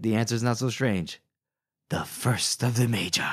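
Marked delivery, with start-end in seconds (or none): 1.9-3.2 hushed, breathy reveal; ending the recap on a whispered reveal; dramatic whisper. Acted. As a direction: whisper it like a secret.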